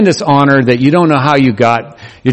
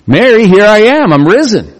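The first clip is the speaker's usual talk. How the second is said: joyful announcement; 8 semitones higher, 3 dB louder than usual. Retold or imitated. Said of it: "led Mary out of despair".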